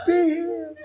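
very high comic squeal, rising then falling; mock faith-healer cry in falsetto; hammed-up squeaky command, a little loud; severed head's healing cry. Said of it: "My body's falling over".

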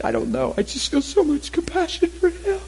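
imitated crying, rising very high; mock bawling. Man crying for a stranger, imitated high bawling. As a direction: blubber it like the man in tears. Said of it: "starts bawling uncontrollably".

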